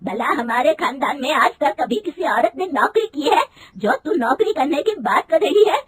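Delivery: mother-in-law berating her daughter-in-law, loud and high; angry scolding. A narrator's reading. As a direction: scold her hard for wanting a job. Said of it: "गुस्से में कहती है".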